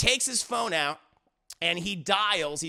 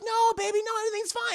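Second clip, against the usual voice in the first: very high mock-wife voice; feigned innocence; 13 semitones higher, much faster than usual. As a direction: cover up in a high, placating voice. Wife covers up to husband.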